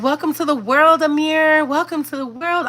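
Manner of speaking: high, tender greeting to a newborn; tender welcome. Retold or imitated. Godmother welcoming the newborn to the world, tender and high.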